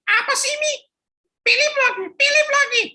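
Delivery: put-on high childish whine; a grumpy kid answering his mum; sulky boy. Philip grumbling back at his mother, high whiny child voice.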